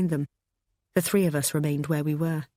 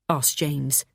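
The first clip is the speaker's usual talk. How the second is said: ordinary delivery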